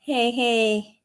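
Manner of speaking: normal talking voice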